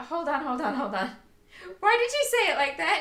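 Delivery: retold startled question; surprised. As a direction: stop her mid-sentence in shock. Retelling sudden realisation.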